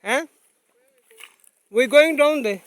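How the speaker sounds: ordinary talk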